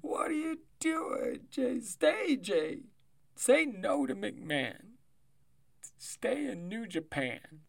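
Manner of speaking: high, drawn-out begging; pleading. Acted. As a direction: beg him to stay, slowly. Pleading with the wrestler.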